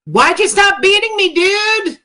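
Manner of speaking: high, whiny put-on voice; whiny customer voice. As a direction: complain like a needy client in a high voice. Voicing the whipped prisoner.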